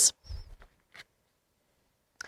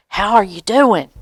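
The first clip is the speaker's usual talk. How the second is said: ordinary delivery, nothing marked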